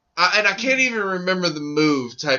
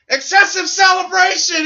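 mock referee call; 9 semitones higher than usual. Referee flagging the wrestler's celebration, hammed-up call. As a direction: announce the penalty like a football referee.

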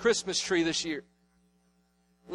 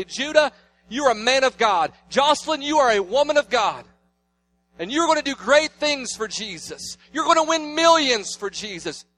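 put-on loud, higher proclamation over his children; bold blessing; 6 semitones higher, 5 dB louder than usual. Father speaking a blessing over his son and daughter, loud and rising. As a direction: declare it over them like a preacher's blessing.

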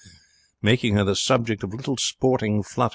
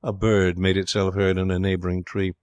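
usual speaking voice

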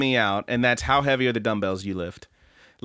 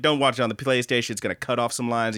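ordinary delivery

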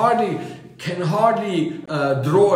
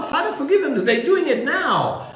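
urgent high plea; 11 semitones higher than usual. Shown as a demonstration. Preacher corrects the quote with a high urgent plea. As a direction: pitch leaps up on Father, forgive them.

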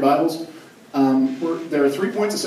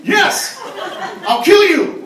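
much higher and louder, hammed-up parental fury; mock furious yell; 7 dB louder, much slower than usual. Fed-up parent yelling at kid, joking mock rage.

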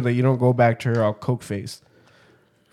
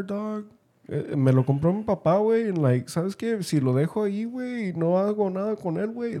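higher, softer, tipsy buddy confiding; drunk Mexican caller; 7 semitones higher, 7 dB quieter than usual. Retold or imitated. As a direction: imitate a drunk friend rambling in Spanglish. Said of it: "Typical drunk Mexican".

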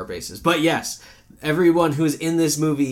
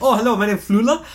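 higher-pitched German accent impression, louder; 9 semitones higher, 5 dB louder than usual. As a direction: introduce yourself in a put-on German accent. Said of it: "he has that accent".

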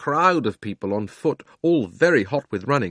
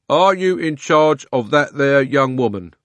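london policeman; 5 semitones higher, 7 dB louder than usual. A narrator's reading. Policeman questions slowly.

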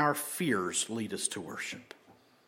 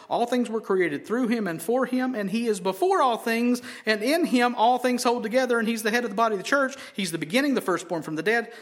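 loud, high scripture proclamation; preaching at full force; 10 semitones higher, 6 dB louder than usual. Acted. Pastor reading scripture to the church, building loud and high. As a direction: build the reading into a ringing climax.

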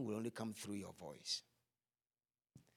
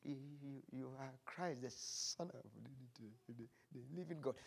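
much softer stammered answer, halting on every word; feeble, sheepish repeat; 2 semitones higher, 10 dB quieter than usual. Acted. Peter repeating his answer to Jesus, still feeble and sheepish. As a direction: repeat it, still too timid to say it out. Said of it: "with a very feeble, sheepish voice".

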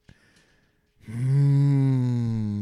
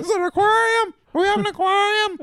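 put-on dotty absent-minded old lady; 4 dB louder than usual. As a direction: play the senile grandmother. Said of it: "They go with the senile route".

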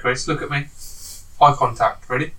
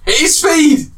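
excited shout; 11 semitones higher, 6 dB louder than usual. Real, not acted. Quizmaster reveals answer excitedly.

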